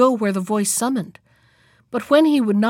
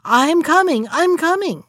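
higher-pitched, urgent cry; loud exclamation; 6 semitones higher, 4 dB louder than usual. A narrator's reading. Wife answering the mysterious voice, loud exclamation. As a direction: call out suddenly in answer. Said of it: "exclaiming in a loud voice".

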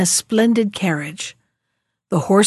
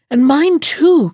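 eager high cry, slow; cried out; 7 semitones higher, 5 dB louder, slower than usual. A narrator's reading. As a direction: cry it out in joy. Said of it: "cried the prince".

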